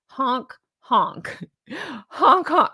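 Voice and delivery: put-on drunk goose honk. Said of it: "I sound like a drunk goose".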